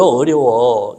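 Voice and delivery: usual speaking voice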